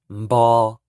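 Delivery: "mbo" loud with M before B; teacher drilling np syllable; Hmong npo. Shown as a demonstration. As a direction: clearly say "mbo". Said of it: "N P is pronounced".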